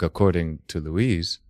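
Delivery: normal talking voice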